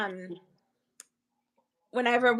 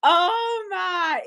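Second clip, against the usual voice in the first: laughing; 6 semitones higher than usual. Real, not acted. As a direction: still laughing, crying out. Laughing at the memory.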